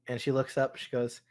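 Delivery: ordinary talk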